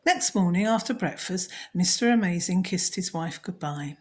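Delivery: ordinary delivery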